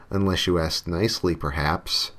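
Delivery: ordinary delivery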